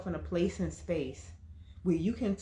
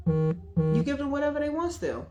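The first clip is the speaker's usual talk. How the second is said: higher and louder, punching "want"; bigger emphatic repeat; 8 semitones higher, 5 dB louder than usual. Real, not acted. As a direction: push it up a notch for effect. Repeats point, rising higher.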